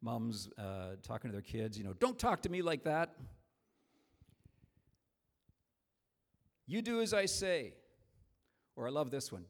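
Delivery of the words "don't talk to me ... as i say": sharp, higher and louder mock scolding; a mom snapping at her kid in the aisle. Mom scolding kid in grocery store.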